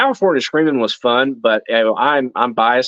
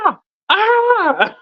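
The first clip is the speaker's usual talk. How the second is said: imitating a panicked rider's cry; imitated yell. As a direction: yell "ah" high, held back in volume. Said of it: "like, yelling".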